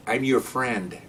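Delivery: slow, mock-friendly supervisor; changed supervisor voice. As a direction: stretch it out as a supervisor befriending a suspect. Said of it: "The voice changes".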